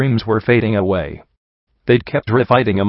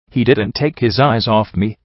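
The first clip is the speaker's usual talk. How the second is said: ordinary delivery, nothing marked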